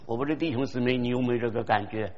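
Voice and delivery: normal talking voice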